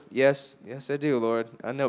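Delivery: sheepish quiet admission. Retold or imitated. Caught by God, admitting it quietly and sheepishly.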